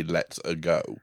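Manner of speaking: soft, understated mock catchphrase; underplay the catchphrase as an imitation; Chris Pratt. Mocking how the film's star would say it, understated. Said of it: "says it like Chris Pratt would say".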